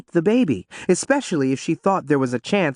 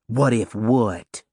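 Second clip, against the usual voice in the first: snapped; 3 semitones lower, much slower than usual. A narrator's reading. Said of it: "Simone snapped".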